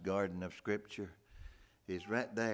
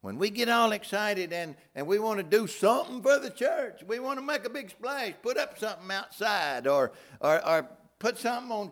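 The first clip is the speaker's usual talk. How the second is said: mock excitement; 9 semitones higher, 5 dB louder than usual. Mocking churchy excitement.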